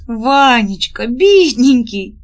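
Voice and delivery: drawn-out, pitying wail; plaintive. A narrator's reading. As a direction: lament over a poor dear man. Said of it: "жалобно проговорила Нюра". (жалобно, нараспев)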